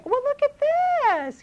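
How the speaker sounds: mother distracting a crying child, singsong; distracting a toddler. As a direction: coax the child to look over here. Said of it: "Say over here".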